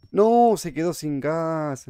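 long "no" of dismay; dismayed wail. Real, not acted. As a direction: wail as the light runs out. Lighter runs out in the dark.

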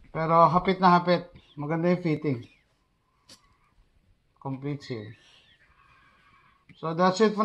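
muffled behind face mask; through a gas mask. Real, not acted. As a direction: voice through respirator filter. Seen 3: Full-face gas mask worn throughout; R frames show masks only on table.

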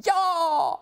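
wife yelling at the TV as he wins; triumphant shout. Acted. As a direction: yell as your skier wins. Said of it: "roper Paprika".

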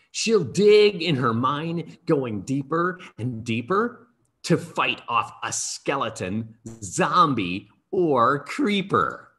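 poem recitation. Acted. Author reading his Minecraft poem to schoolkids, lively rhythmic recitation. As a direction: read it like a bouncy rhyme for kids, savouring each couplet.

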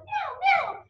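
cute high meow; high cat meow. Pretend. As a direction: meow like a little kitty. Imitating cat in song.

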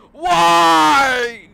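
shouted high and loud, cracking with frustration; frustrated shout. Real, not acted. To the game after crashing, an exasperated shout. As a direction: scream the question at the screen.

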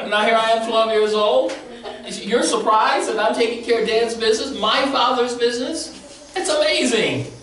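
bursting with delight at the insight, almost shouting; laughing, high-pitched excited sermon voice; excited preaching. Real, not acted. Preacher thrilled by a listener's answer.

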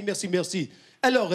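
ordinary talk

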